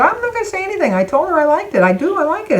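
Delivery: squeaky flustered denial; flustered high protest. Retold or imitated. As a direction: protest your innocence in a rush, voice jumping high. Defensive protest to boyfriend.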